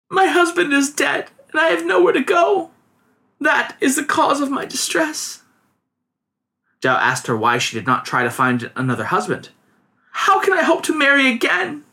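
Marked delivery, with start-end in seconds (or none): 0.1-5.4 put-on high, plaintive young widow; young woman voice. A narrator's reading. 10.1-11.8 put-on high, plaintive young widow; young woman voice. A narrator's reading.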